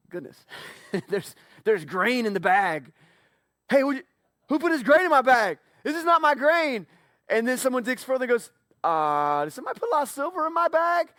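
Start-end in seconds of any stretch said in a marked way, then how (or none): 3.7-6.9 higher and louder, panicked; a startled brother shouting over his bag; alarmed outcry. Acted. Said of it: "suddenly they're freaking out". 8.8-11.1 panicky. Acted. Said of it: "suddenly they're freaking out".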